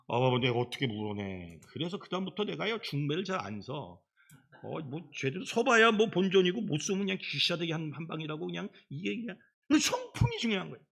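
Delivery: usual speaking voice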